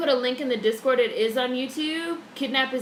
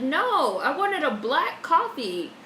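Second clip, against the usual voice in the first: retold complaint; 6 semitones higher, 4 dB louder, slower than usual. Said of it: "I said".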